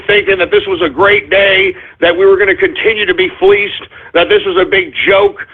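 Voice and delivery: ordinary talk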